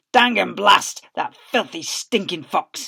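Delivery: loud, then slow on stinking; cursing farmer. Acted. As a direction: curse it through your teeth.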